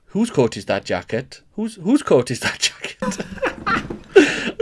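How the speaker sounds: repeated Welsh practice phrase, breaking into laughter; Welsh accent warm-up. Acted. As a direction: feel for the Welsh accent, then crack up. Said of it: "use the Jimmy Carr technique to try and get into it".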